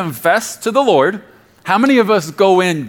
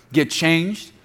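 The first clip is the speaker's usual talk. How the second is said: ordinary talk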